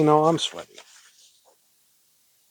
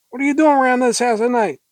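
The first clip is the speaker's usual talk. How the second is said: high, urgent demand; pitched-up interrogation; frantic challenge; 8 semitones higher, 3 dB louder than usual. Acted. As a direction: confront the intruder hysterically. Heroine challenges stranger in the dark.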